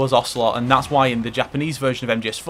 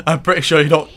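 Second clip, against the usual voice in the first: louder, higher words through a laugh; laughing while talking; 3 semitones higher, 7 dB louder than usual. Real, not acted. Laughs at headbutt off cliff.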